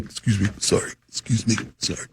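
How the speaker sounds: low, soft mumbled politeness; hushed apology. Retold or imitated. Man working through a quiet room, hushed apologies. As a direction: keep it small, as if squeezing past people.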